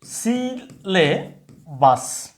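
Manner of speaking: সিলেবাস dragged out very slowly; slow model pronunciation. Shown as a demonstration. Teacher presenting a word, very slow. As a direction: spell out সিলেবাস by sound, slowly. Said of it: "একটা হলো".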